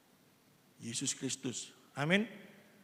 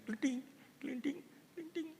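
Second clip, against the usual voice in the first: rolling stone sound; 7 semitones higher than usual. Retold or imitated. Stone rolled off the roof.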